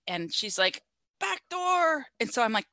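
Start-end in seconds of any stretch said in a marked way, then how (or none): 1.2-2.1 high-pitched call from inside. Retold or imitated. Trapped woman to the arriving medic, imitated calling out.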